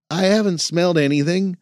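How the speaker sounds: put-on young man voice. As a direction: protest it like a young recruit. Jason defensive.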